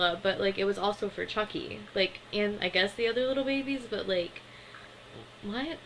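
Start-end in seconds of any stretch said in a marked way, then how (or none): none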